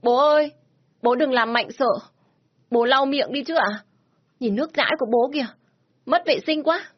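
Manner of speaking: put-on high, loud child's voice wailing for his father; a small boy calling his dad through tears; crying little boy. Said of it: "nên cứ khóc bù lu bù loa lên". (giọng bé trai cao, gào khóc gọi bố)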